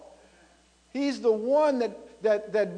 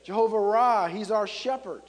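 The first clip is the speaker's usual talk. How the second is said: ordinary talk, nothing marked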